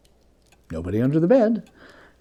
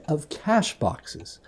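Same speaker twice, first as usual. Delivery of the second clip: plain reading voice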